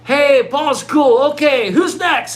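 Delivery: high, slow, mock-cheerful crowd voice; bored crowd. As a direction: drag it out like a polite bored audience. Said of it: "Everybody's going".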